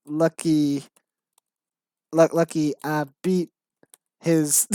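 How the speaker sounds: lightly voiced gangster, word by word; mock gangster with separated words. Writer reading out own script, mock gangster. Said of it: "stereotype of how gangsters talk".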